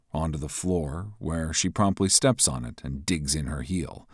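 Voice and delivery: ordinary talk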